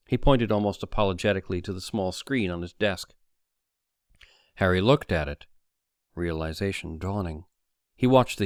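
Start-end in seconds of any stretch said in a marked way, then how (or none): none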